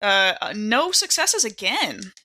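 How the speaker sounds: ordinary talk, nothing marked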